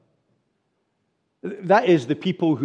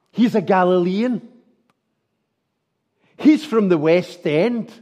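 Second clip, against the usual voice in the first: mock sneer; 5 dB louder than usual. Preacher voicing a mocker who sneers at Peter's accent. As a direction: sneer at the outsider's roots. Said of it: "third accusation that comes".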